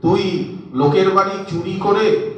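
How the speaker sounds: a touch of wide-eyed astonishment; astonished scolding. A narrator's reading. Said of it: "Yashoda বিস্মিত হয়ে চোখ দুটো বড় বড় করে বললেন".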